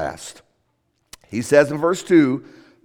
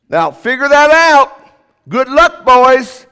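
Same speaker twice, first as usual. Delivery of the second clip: loud, higher mock "good luck, boys"; sarcastic; 6 semitones higher, 6 dB louder, slower than usual. Preacher mocking vague advice, loud and sarcastic. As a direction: throw it out with a scoff.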